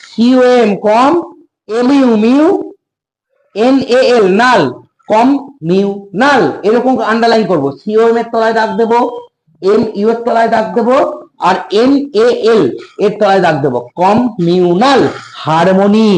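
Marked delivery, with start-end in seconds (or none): 0.1-6.4 "কমিউনাল" split into syllables, spelt out; over enunciating. Shown as a demonstration. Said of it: "কেটে কেটে উচ্চারণ করবো". 14.0-15.0 "কমিউনাল" slow and drawn out; over enunciating. Shown as a demonstration. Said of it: "কেটে কেটে উচ্চারণ করবো".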